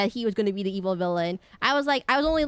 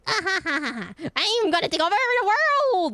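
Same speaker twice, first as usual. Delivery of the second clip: high, loud cackling villain; hammed-up villain laugh and world-conquest boast; evil villain; 10 semitones higher, 5 dB louder than usual. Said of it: "the evil villain".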